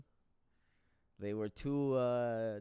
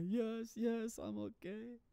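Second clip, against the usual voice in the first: much higher mock-whiny girlfriend voice; 10 semitones higher, 4 dB quieter, slower than usual. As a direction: play her up, high and pitiful. Voicing crying girlfriend after crash.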